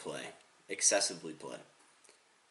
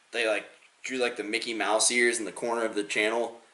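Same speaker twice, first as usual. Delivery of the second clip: ordinary delivery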